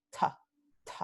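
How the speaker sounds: "T" with a strong puff of air; aspirada. Shown as a demonstration. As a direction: release "T" with extra exhalation. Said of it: "con aspiración". ("T" aspirada, con soplo de aire)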